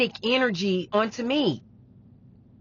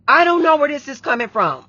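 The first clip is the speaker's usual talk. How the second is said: loud, high exclamation of confusion; raised-voice retelling of being at a loss; retold bewildered outburst; 7 semitones higher, 9 dB louder than usual. Retells own anxious bewilderment.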